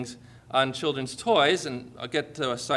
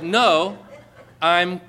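loud, drawn-out COLD in mock insistence; retold insistence; 8 dB louder, much slower than usual. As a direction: imitate a woman stressing she's cold. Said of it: "with greater consternation in her voice".

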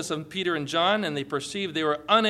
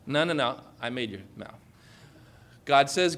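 normal talking voice

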